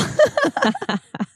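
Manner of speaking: light high-pitched laugh, breaking up; real laugh. Real, not acted. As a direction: let a real laugh escape. Laughing at failed accent attempt.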